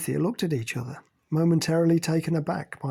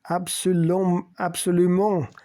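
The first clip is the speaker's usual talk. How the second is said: mock French, louder and higher; 4 semitones higher, 5 dB louder, slower than usual. A narrator's reading. Pascal chiming in, mock French agreement. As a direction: chime in with a mock French flourish. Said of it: "Pascal chimed in".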